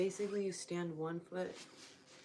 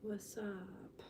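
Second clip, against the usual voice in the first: whispering; 3 semitones higher, 5 dB quieter than usual. Real, not acted. Whispered greeting.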